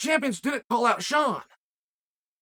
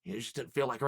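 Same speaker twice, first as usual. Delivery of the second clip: low, much softer mock excuse voice; a hammed-up low, soft mock excuse; mock classmates; 12 semitones lower, 9 dB quieter than usual. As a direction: drop way down and mumble the lame excuse like the classmates. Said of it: "The classmates are".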